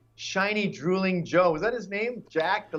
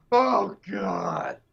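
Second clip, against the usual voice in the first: bored, dismissive, swelling louder; mock bored dismissal; 2 semitones higher, 4 dB louder, much slower than usual. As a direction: wave it off like it's a chore. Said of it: "And the Dems go".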